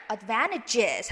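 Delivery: "advantages" ending in a clear "jiz"; voiced "-is" ending. Shown as a demonstration. Teacher showing the plural, extra "is" syllable. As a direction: say "advantages" with a full final "is". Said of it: "is 사운드".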